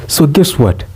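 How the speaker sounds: normal talking voice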